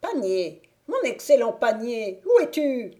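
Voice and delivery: rising to a loud, high witch cry. A narrator's reading. Witch calling her hidden basket on entering: building high cry. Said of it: "s'écria".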